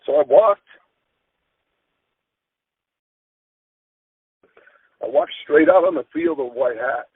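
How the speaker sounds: holding back tears; choked up. Real, not acted. Coach walking onto the field after the news, choked up.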